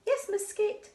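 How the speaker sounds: high squeaky little fish voice; pupil answering. Acted. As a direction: chirp it like an eager small pupil. Pupils answer teacher's register.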